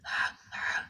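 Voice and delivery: ہا whispered from the middle of the throat; breathy throat ha. Shown as a demonstration. Teacher showing the throat sound of ہا in a whisper. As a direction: push air through a narrowed throat for ہا. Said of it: "جیسے گلے بند میں آواز آتی ہے".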